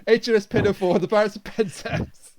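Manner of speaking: ordinary talk, nothing marked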